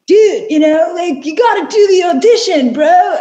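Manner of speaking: loud, high surfer dude; Jimmy's surfer voice. Retold or imitated. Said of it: "doing this bizarre surfer voice".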